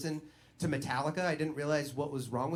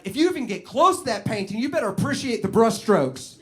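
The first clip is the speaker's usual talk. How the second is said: put-on defiant kid Arlo; 4 semitones higher, 6 dB louder than usual. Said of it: "he's just like".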